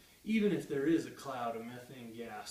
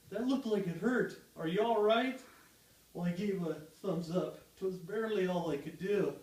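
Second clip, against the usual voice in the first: usual speaking voice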